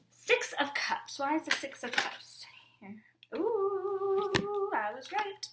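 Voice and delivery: high, unvoiced whisper with rising excitement; a hushed thrilled discovery; excited whisper. Real, not acted. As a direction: whisper it like you just spotted something. Hushed excitement over a card.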